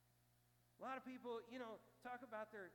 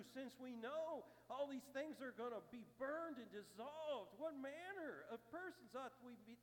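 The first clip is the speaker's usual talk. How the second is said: preaching; 4 semitones higher, 3 dB louder than usual. Acted. Pastor exhorting the congregation to store treasures in heaven, fervent. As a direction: build the call to action with fire.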